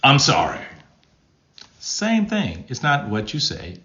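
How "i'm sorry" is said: punched-out, curt sorry; blurted apology at full voice; forceful apology. Shown as a demonstration. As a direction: throw the apology out hard. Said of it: "it's how you say it".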